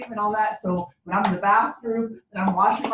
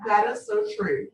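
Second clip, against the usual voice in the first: normal talking voice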